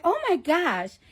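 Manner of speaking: high imitation of a Staten Island girl; mock Staten Island girl gasp. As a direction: pitch it up like the Staten Island doctor.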